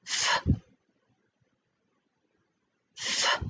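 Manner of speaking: f breathed alone, soft and voiceless; isolated f sound. Shown as a demonstration. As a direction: sound out the lone f. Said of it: "that ends in the sound".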